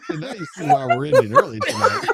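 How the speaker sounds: high-pitched, loud, laughing through the words; can barely get the words out for laughing; helpless laughter. Real, not acted. Host wrapping up the stream, dissolving into laughter.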